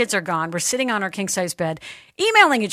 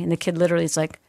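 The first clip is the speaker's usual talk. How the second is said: ordinary talk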